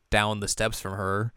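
ordinary talk

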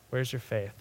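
low, soft, tender question; gentle rebuke. Acted. God to a panicking believer, gently rebuking. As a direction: ask it quietly, as a loving correction. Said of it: "For me, this is how I hear it".

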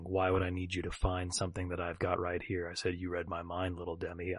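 low, hushed hardboiled narration; noir detective. Acted. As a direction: brood like a film noir gumshoe. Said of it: "I'm here playing Kit Russell, Private Eye".

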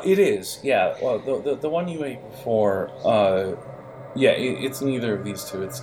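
ordinary talk, nothing marked

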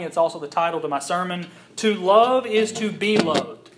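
earnest pulpit voice building to scripture; preaching. Acted. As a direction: preach it out to the congregation. Preaching the sermon.

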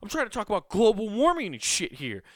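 a vain star bristling at being upstaged; mock DiCaprio. DiCaprio snapping at a guest who coughed, mock anger. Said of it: "he's just like".

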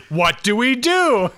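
high, slow panicked cry with a laugh; hammed-up panic, laughing through it; mock panic. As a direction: play the panicking boss for laughs. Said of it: "He's like".

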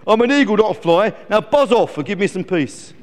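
loud, indignant eagle; exasperated eagle outburst; cross shouting. Acted. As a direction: dismiss the fly angrily. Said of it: "shouted Glen".

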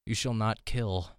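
ordinary delivery

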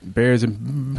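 usual speaking voice

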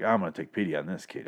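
a hint of a low drifter voice; Victor. Podcaster quoting Victor taking pity on a kid, light impression. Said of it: "I'm gonna do my best Victor impression".

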